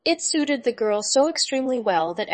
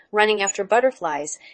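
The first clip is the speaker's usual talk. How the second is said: usual speaking voice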